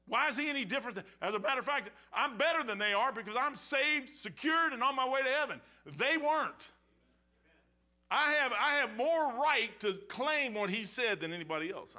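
high, loud, rising preacher's cry; preaching at full force. Acted. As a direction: climb to a triumphant shout from the pulpit.

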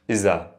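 "Iza" with a clear z in place of th; dialect pronunciation. Shown as a demonstration. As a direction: Levantine "Iza", buzzed z in the middle. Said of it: "we pronounce it iza".

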